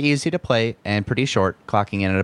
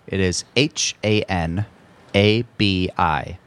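ordinary talk, nothing marked

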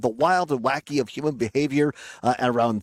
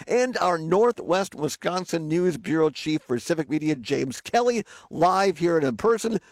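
ordinary talk, nothing marked

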